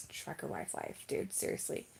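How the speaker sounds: breathy resigned whisper under her breath; muttered whisper of trucker wife woes; whispered aside. Real, not acted. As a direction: drop to a tired whisper. Resigned aside about trucker life.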